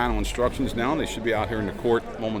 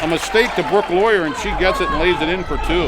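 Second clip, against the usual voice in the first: excited play-by-play; 8 semitones higher, 9 dB louder than usual. Real, not acted. Basket scored, commentator builds up.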